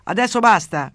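loud, high booming command; the priest as God's voice, shouting loud. Acted. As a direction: thunder it like God through a megaphone. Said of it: "urlò".

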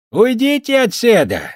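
put-on high, loud, slow slurred drunk; drunken voice. A narrator's reading. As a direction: slur it like a drunk. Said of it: "пьяным голосом".

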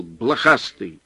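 ordinary delivery

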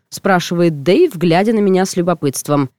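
ordinary delivery, nothing marked

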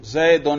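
زیدن ending in -un; raf ending. Shown as a demonstration. Teacher has the student show the raf, زیدن ending in -un. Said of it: "رفع پر ہم تلفظ کر رہے ہیں".

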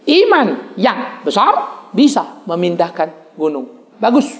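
slow, drawn-out recitation with a rise on iman; slow, deliberate teaching read; slow demonstration. Shown as a demonstration. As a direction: spell it out slowly for the congregation.

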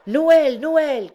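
high, loud jubilant cry; crowd cheer. A narrator's reading. The people acclaiming the announcement, crying out. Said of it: "cria le peuple".

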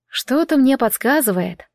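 plain reading voice

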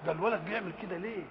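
ordinary talk, nothing marked

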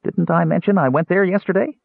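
high-pitched, innocent casual question; matter-of-fact. A narrator's reading. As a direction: toss it off as nothing special. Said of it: "as if it were the most common thing".

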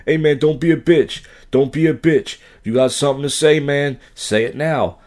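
mock aggressive street challenge; imitated yelling. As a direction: mimic an angry man picking a fight. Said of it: "Why are you yelling at me?".

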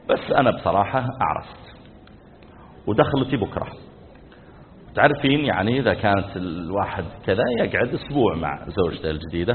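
low, subdued, hesitant husband; sheepish confession. Retold or imitated. Husband sheepishly confesses second marriage. (اعتراف خافت متردد ومحرج)

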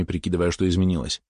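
usual speaking voice

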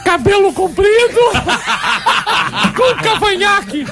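high falsetto woman voice, laughing through it; man doing a woman's voice. Acted. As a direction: squeak it out like a put-on lady. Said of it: "Então faz uma voz de mulher". (voz de mulher em falsete, rindo)

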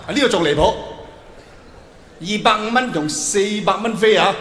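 ordinary delivery

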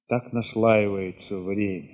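ordinary talk

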